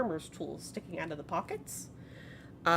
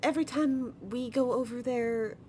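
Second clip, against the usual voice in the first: [name]; 5 semitones higher than usual. Acted. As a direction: answer gently in a lightly voiced sweet grandmother. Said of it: "[name] speaks up".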